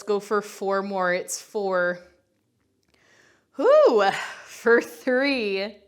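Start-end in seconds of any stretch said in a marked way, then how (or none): 3.6-4.1 high, loud falling whoo; exertion whoop. Real, not acted. Instructor struggling through the last reps, high loud whoop. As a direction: let out a big whoop of effort.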